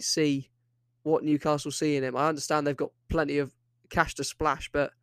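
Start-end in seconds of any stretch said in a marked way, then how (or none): none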